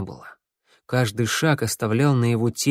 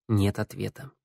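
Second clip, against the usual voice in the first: ordinary delivery, nothing marked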